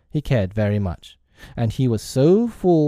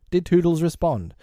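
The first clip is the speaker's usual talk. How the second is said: ordinary delivery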